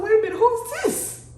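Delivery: high, loud double take; acted-out shock, voice shooting way up; shocked disbelief. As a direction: blurt it out in startled disbelief. Acting out shock at the ending.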